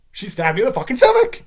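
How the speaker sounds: loud exasperated punchline; emphatic shout. Real, not acted. Ranting about a hypothetical wife, loud exasperated shout.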